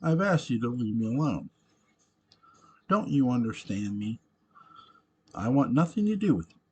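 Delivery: fed up and forceful, peaking on the last sentence; angry rebuff. A narrator's reading. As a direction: push him away with rising anger. Character angrily rebuffs unwanted visitor.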